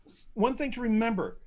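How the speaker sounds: ordinary talk, nothing marked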